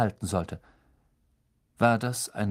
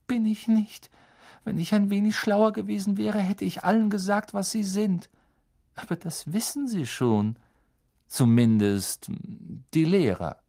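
put-on higher girl's voice, hurt and reproachful; upset clever girl; 10 semitones higher than usual. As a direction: speak as a bitter schoolgirl. Hermine's held girl's voice.

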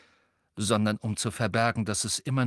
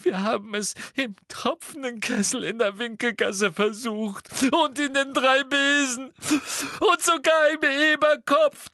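very high, wailing sobs, building louder; sobbing; 4 dB louder than usual. A narrator's reading. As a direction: wail it out in a squeaky, tearful girl's voice. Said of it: "schluchzte Hermine".

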